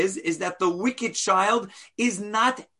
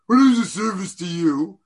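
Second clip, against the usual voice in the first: loud, pointed rhetorical jab; rhetorical challenge; 6 dB louder than usual. Shown as a demonstration. Teacher demonstrates the question read as a sneer. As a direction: fling it at the rabbi as a challenge. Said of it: "I can read it as".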